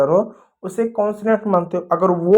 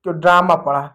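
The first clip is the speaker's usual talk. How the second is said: ordinary talk, nothing marked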